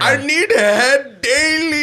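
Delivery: high, slow mock Indian accent, laughing. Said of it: "Russell Peters impression".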